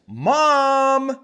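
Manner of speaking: put-on high, loud child's call of Mom. Kid on the beach calls for mom, high and loud. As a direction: shout it like a small kid on the beach.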